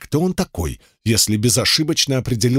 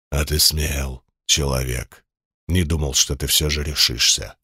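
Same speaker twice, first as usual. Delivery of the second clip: insinuating sinister voice; 7 semitones lower than usual. Acted. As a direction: purr it low like a sinister giant demon. Demon boss voice held.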